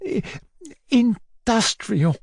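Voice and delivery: high, slow, faltering guess; cowed answer. Acted. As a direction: venture it timidly. Whimpering boy picks an answer.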